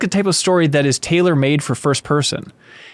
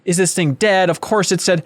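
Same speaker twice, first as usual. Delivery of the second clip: normal talking voice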